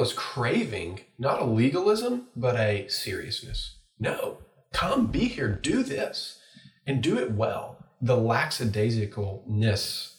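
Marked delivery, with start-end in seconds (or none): 4.0-7.6 earnest plea. Retold or imitated. Voicing what millennials crave.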